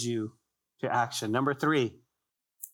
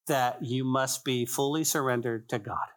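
ordinary delivery

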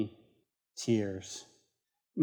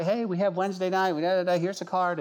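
higher, brisk imitation of a friendly pitch; eager inviting; 6 semitones higher, faster than usual. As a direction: pitch the invitation breezily, lightly acted. Friend invites strangers to church.

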